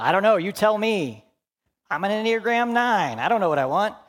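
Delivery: high, loud, helpless protest; flustered indecision. Acted. Enneagram nine asked what they want, flustered indecision. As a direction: throw the question back, comically at a loss. Acted indecisive person answering the question.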